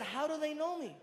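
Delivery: caller voice. Retold or imitated. Voicing a caller in story.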